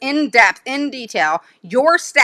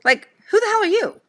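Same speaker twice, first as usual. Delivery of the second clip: indignant; 12 semitones higher than usual. Real, not acted. Outraged at the teacher.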